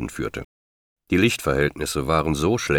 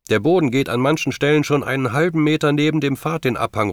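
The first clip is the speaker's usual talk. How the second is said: high, urgent raised voice; called warning; 10 semitones higher, 3 dB louder than usual. A narrator's reading. Julie warning John about the crumbling cliff path, calling urgently. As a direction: call a warning across the wind.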